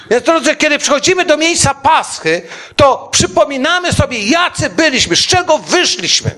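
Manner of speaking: carried away by the message, preaching at full voice; fervent preaching. Acted. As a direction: rise to a fervent plea before the congregation. Preacher rising to full force.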